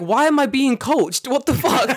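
loud, high-pitched comic outrage; hammed-up exasperated yell; indignant outburst. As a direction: protest in loud, high disbelief. Acted outrage at being coached.

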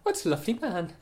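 high, mock-cheerful sarcasm; sarcastic. Real, not acted. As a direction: praise him with obvious irony. Sarcasm about the violent man.

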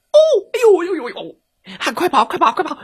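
very high yelps and pleas; panicked wolf. Acted. Wolf jolted awake by the horn, high panicked yelping. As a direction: yelp in panic, then urge flight. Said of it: "大嘴狼被吵醒了".

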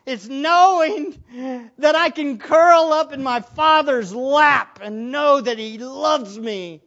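crying out through tears; overwhelmed preacher cries out with love; tearful cry. Real, not acted. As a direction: voice breaking into loud tears. Preacher overcome with emotion.

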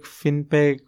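Finpeg in two syllables, "FIN-peg"; short i in Finpeg, no middle vowel; short-i pronunciation. Shown as a demonstration. Guest trying out the company name, Finpeg as "fin-peg". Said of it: "I don't know the pronunciation".

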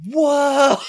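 high, loud awestruck cry; hammed-up wonder at a sudden sight; imitated amazement. Brother sees turkeys glide over the car, imitated amazement.

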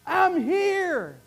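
high, earnest pleading call; Jesus pleading through tears with the crowd; weeping plea. Acted. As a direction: beg them to see him. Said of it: "Jesus is standing there weeping and saying".

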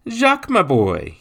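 high, loud surprised greeting; shocked gentleman. A narrator's reading. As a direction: greet the child in astonishment. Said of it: "shocked at seeing the child".

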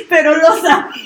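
words through laughter; laughing. Real, not acted. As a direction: laugh while saying it. Laughing after teasing exchange.

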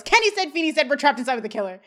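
high, rushed mock yell; imitating a frantic yell; retold panicked yell. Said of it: "Eric yells".

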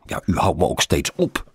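plain reading voice

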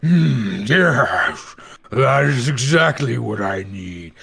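a greedy creature savoring its food; hungry monster, pleased; boiler monster voice. Acted. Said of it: "and he's like".

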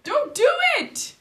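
high loud panicked shout. Real, not acted. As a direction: yell to stop her. Stopping sister touching nails.

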